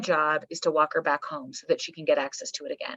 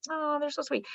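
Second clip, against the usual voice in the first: put-on higher and softer, cooing over the kids; doting parent; 8 semitones higher, 9 dB quieter than usual. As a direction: coo fondly at sweet children. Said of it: "You're like".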